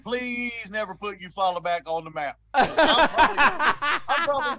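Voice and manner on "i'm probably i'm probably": laughing. Real, not acted. Laughing at his own joke.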